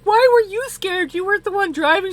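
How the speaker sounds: high, loud mock disbelief; incredulous retort. Driver challenging her scared friend, incredulous. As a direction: fire it back loudly, pitched right up.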